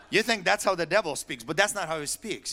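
normal talking voice

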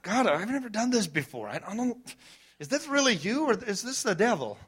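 mock-worried question to God; imitating his doubting self. Pastor retells asking God, mildly anxious voice. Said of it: "I'm like".

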